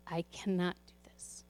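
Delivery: breathless whisper, worn out; retold despairing whisper before church. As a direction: whisper it like you cannot go on.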